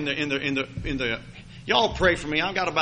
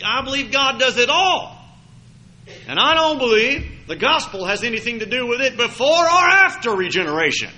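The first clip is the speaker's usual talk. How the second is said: fervent raised preacher voice; preaching; 8 semitones higher, 7 dB louder than usual. Acted. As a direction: build it up like a pulpit climax. Preaching at full force.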